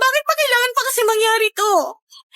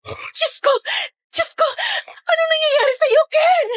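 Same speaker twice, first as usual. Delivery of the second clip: panicked sobbing cry; horrified crying; 7 semitones higher, slower than usual. Acted. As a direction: shriek in horror through tears. Horrified at Ken's act. (takot na umiiyak na sigaw)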